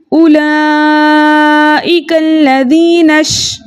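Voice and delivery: slow chanted "उलाइका लज़ीनश" with the नश joined and drawn out; recitation demo. Shown as a demonstration. Teacher shows students how to join the नून and शीन, slow chant. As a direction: chant it slowly as a model for learners. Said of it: "हम कैसे पढ़ेंगे?".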